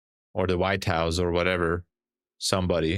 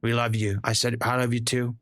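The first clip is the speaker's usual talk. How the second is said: higher, boastful Trump voice rambling about beautiful boats; hammed-up Trump bragging, pitched up; streamer's comedy sketch as Trump, higher and boastful; Trump impersonation; 4 semitones higher than usual. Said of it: "I did a great Trump, Trump impersonation".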